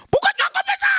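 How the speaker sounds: very high shrieked rebuke; parent yelling. Acted. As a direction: scream at the child.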